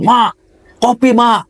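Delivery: high call for mom from the porch; lazy son calling out. Retold or imitated. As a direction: holler up at mom for coffee. Lazy son yelling for mother. (memanggil emak minta kopi dengan suara tinggi)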